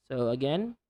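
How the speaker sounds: usual speaking voice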